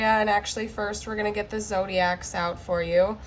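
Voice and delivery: ordinary talk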